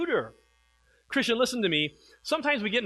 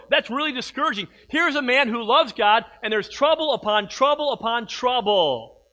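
louder, building preacher's cry; preaching at full force; 4 semitones higher, 5 dB louder than usual. Acted. Pastor pressing the church about trouble, building preaching. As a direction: lift the room with mounting urgency.

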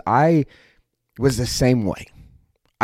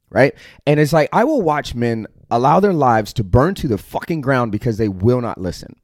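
ordinary talk, nothing marked

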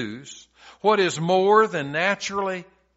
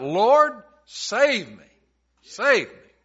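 calling out for help; 5 semitones higher, 5 dB louder, slower than usual. Retold or imitated. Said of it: "Simon calls out".

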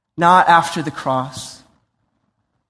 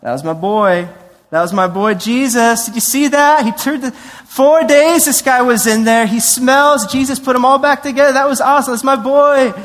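put-on high, loud bragging about his boy; excited proud father; 7 semitones higher, 5 dB louder than usual. Proud father showing off his son after Lazarus, excited. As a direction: brag to the crowd, thrilled. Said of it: "for the Father to show up and be like".

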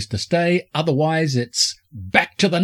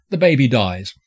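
ordinary talk, nothing marked

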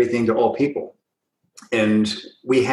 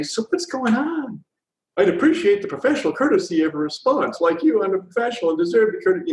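higher-pitched, mock-aggrieved demand; guilt-tripping; 7 semitones higher than usual. Recruiter chasing a silent candidate, guilt-tripping her. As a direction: act out a put-upon plea for courtesy. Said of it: "can't guilt trip people into compliance".

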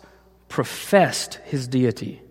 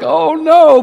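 loud, high mock-fearful cry; great fear; 13 semitones higher, 10 dB louder than usual. Preacher mimics his son's fright at the blood, great fear. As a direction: imitate a frightened child wailing. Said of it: "this great fear of like".

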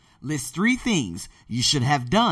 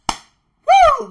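very high, loud "woo"; excited whoop; 14 dB louder than usual. Real, not acted. Podcaster whooping before the sign-off, excited whoop. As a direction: let out a piercing whoop.

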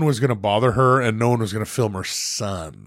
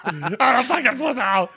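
ordinary talk

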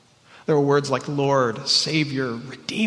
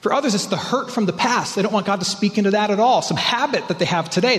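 ordinary talk

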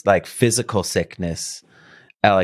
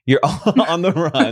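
laughing; 6 semitones higher, slower than usual. Real, not acted. Host laughs at guest's phrase.

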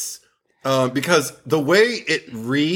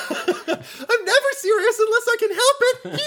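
very high, laughing mock voice; host mocking Tina's line. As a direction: squeak it out through laughter. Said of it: "She would have been like".

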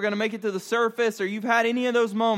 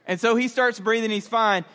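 usual speaking voice

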